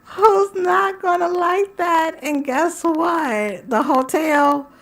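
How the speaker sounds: high, drawn-out squeal after laughing; breaking up. Real, not acted. Host overcome by her own joke, squealing. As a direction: squeal it slowly, still overcome.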